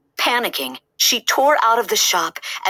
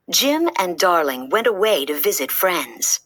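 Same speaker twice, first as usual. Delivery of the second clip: ordinary delivery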